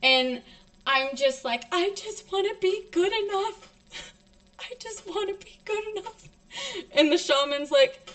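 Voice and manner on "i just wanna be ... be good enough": high, thin tearful pleading; crying plea. Retold or imitated. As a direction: re-enact a sobbing child's plea, voice pitched way up. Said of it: "I'm crying".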